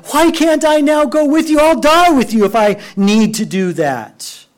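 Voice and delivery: high, loud eager protest; loud brash vow; bold protest. Retold or imitated. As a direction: insist you'll die for him. Peter's boast quoted.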